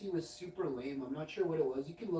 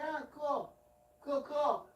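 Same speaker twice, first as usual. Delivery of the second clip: dismissive; 10 semitones higher than usual. Retold or imitated. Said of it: "I'm like".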